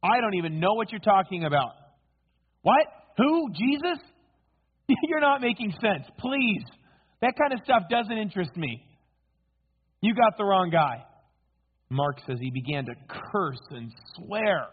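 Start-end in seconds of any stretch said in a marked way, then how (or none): 0.0-11.0 high, incredulous brush-off; feigned ignorance. Acted. Peter bluffs the crowd to get out, indignantly feigning ignorance. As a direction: protest innocence in a raised, dismissive voice.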